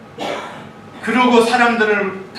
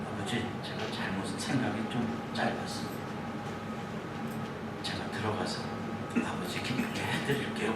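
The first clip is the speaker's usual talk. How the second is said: hushed son; 10 semitones lower, 13 dB quieter than usual. Pretend. 큰아들의 가상 대답.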